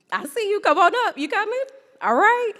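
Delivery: ordinary talk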